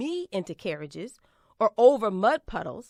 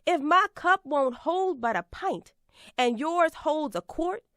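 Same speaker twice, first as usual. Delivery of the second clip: ordinary talk, nothing marked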